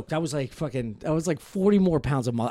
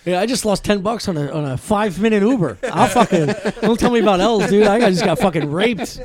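loud, higher, laughing through it; laughing rant; 7 semitones higher, 10 dB louder than usual. Real, not acted. Joking with friends about losses, loud and laughing.

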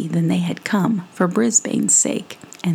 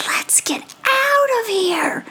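high, slow whisper; 14 semitones higher than usual. Acted. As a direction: hiss it urgently so no one wakes. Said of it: "Brisbane whispered to Stella".

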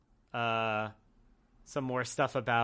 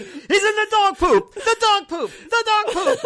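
mock commentator; 8 dB louder than usual. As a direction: ham up a commentator chanting. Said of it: "they just kept saying".

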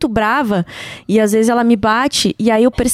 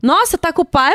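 higher, incredulous question; mock surprise; 4 semitones higher than usual. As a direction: echo it back as if astonished. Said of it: "porque ele".

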